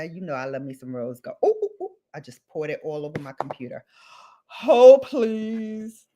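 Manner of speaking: ordinary talk, nothing marked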